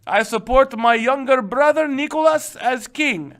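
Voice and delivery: high-pitched mock-Russian proclamation; hammy Russian accent. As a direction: declare it in a put-on Russian accent. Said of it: "You guys like my Russian accent?".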